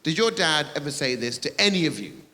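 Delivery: ordinary talk, nothing marked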